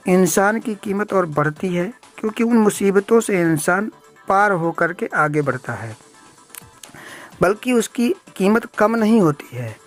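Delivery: usual speaking voice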